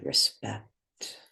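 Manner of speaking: normal talking voice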